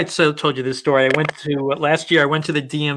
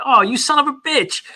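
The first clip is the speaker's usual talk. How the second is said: mock exclamation; 11 semitones higher than usual. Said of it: "like that".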